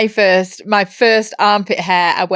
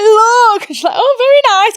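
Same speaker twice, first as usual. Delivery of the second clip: excited; 13 semitones higher, 5 dB louder than usual. Retold or imitated. Showing mum first armpit hair.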